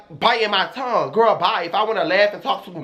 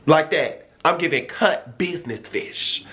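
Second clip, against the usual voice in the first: ordinary talk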